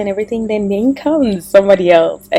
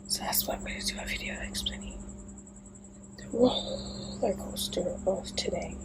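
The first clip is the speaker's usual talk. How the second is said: soft unvoiced whisper; 17 dB quieter than usual. Real, not acted. Vlogger whispering why she's making a video. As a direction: whisper the plan to the camera.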